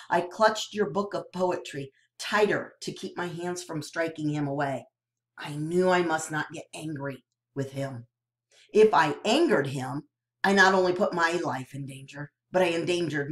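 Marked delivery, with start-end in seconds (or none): none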